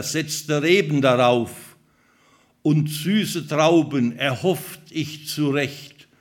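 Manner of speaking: the vineyard owner calling out to the people of Judah; dramatic poem reading. Acted. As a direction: proclaim it like a prophet's lament. Reads Isaiah vineyard song aloud.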